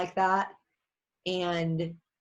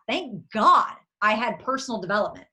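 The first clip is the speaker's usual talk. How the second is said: ordinary delivery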